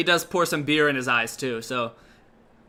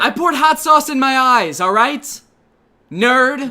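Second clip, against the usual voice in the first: loud, higher defensive shout; defensive snap; 8 semitones higher, 8 dB louder than usual. Pretend. Answering questions about his eye patch, shouting. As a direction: bark it back defensively. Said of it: "What happened?".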